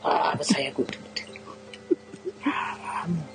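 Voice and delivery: whispered groan. Real, not acted. Groaning over own lie.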